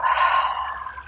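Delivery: long, soft audible exhale; demonstrated exhale, fading away; letting-go sigh. Shown as a demonstration. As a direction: let the whole breath go in a sigh. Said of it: "let it go".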